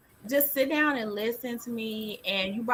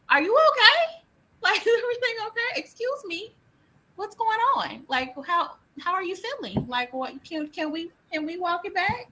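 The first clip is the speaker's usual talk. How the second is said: gentle concerned parent; 10 semitones higher than usual. Retold or imitated. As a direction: coax her gently, lightly higher. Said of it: "I be like".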